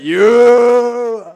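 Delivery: loud, high triumphant shout; jubilant yell. Retold or imitated. Celebrating after the officer shook his hand, a loud cheer. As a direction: punch the air with it.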